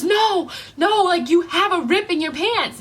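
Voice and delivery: high-pitched imitation of an urgent girl; insistent correction. Stranger correcting her about the ripped pants, insistent.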